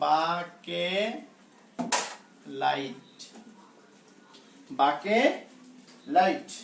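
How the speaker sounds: বাকেলাইট stretched very slowly, বাকে held, then লাইট; careful pronunciation. Shown as a demonstration. Teacher modelling a slightly unusual word to repeat, careful pronunciation. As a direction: model বাকেলাইট piece by piece with long vowels. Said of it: "উচ্চারণটা একটু অন্যরকম".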